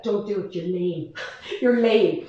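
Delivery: low, soft, scornful daughter voice; a light impression of the girls mocking mum; mock teen scorn. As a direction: sneer it like an embarrassed teen. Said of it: "They're like".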